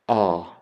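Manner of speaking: "ah" as an open ah like in father; ah sound. Shown as a demonstration. Lecturer demonstrating the alpha sound to students, open ah. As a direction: say "ah" long and open. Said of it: "alpha makes an ah sound".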